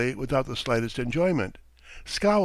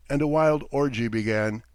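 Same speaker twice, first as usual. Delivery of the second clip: plain reading voice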